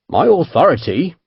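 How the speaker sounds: lightly grand Devonshire captain. A narrator's reading. Captain answering the merchant's challenge, grandly. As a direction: declaim it with mock grandeur. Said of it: "Chase said grandly".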